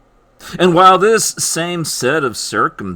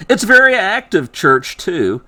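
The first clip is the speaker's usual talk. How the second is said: ordinary delivery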